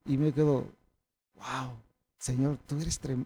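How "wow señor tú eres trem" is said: much softer, lower aside to God; quietly acted-out amazement; hushed awe. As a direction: drop the voice in quiet wonder. Acting out his quiet awe to God.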